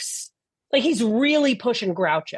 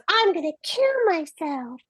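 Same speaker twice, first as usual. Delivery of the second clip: put-on high-pitched wail of a threat; annoying melodrama; 8 semitones higher than usual. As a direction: imitate an annoying, overdramatic woman. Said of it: "She's also very annoying".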